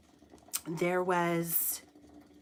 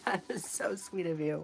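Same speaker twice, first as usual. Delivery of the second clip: laughing; 3 dB quieter than usual. Real, not acted. Laughs while thanking the chatter.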